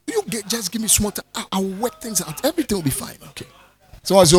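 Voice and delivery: ordinary talk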